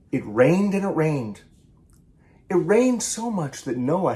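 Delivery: lively picture-book read-aloud, each animal line acted; story reading. A narrator's reading. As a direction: read it to children with bounce and play. Author reads picture book aloud.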